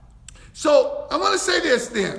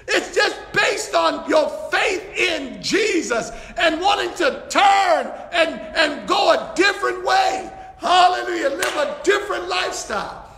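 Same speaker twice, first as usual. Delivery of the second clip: excited preaching; 4 semitones higher than usual. Real, not acted. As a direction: preach it with joy, near shouting. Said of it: "I'm getting excited".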